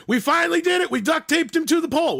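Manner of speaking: high, loud retold boast; triumphant announcement. Calling friends to report the taping, triumphant announcement. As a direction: re-enact a proud, shouted announcement. Retelling an excited phone call boast.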